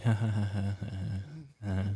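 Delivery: imitated nervous laugh; fake-laugh along uneasily; forced, awkward string of ha-has. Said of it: "I start awkwardly laughing too".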